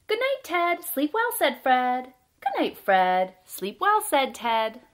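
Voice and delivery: loud, deliberate picture-book narration with pauses; reading to children. A narrator's reading. Storyteller reads a bedtime tale about Fred and Ted, lively read-aloud. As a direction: read it out with big, bouncy emphasis.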